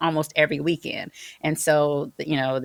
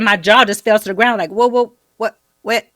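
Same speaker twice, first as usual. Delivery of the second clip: higher and louder, replaying a stunned mother's disbelief; retold astonishment; 6 semitones higher, 7 dB louder than usual. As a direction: tumble over the whats as if jaw-dropped. Retold stunned reaction, family story.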